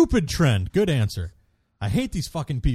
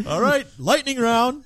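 game show host; 12 semitones higher, 5 dB louder than usual. Acted. Host announces game round.

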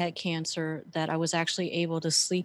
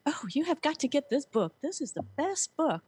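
high-pitched, gushing Southern sales clerk; Southern accent; 9 semitones higher than usual. Retold or imitated. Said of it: "with this Southern accent, she's like".

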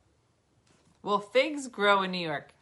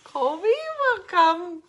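high, slow, sing-song; drawn-out announcing tune, much higher; 11 semitones higher, 7 dB louder, much slower than usual. Real, not acted. Teasing about the pushy dog, high sing-song.

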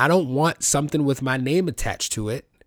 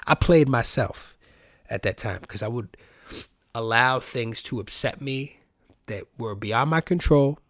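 usual speaking voice

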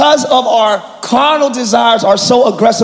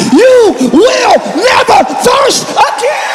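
high, full-force preacher shout; real excited pulpit yelling, pitched high; yelling, excited preaching; 7 semitones higher than usual. Real, not acted. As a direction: preach it at a fervent yell, building to the last word. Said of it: "I'm yelling".

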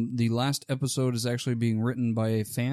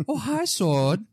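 Tommy Wiseau impression; 8 semitones higher, 3 dB louder, much slower than usual. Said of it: "Just let Tommy Wiseau direct".

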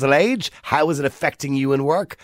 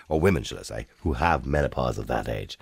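ordinary talk, nothing marked

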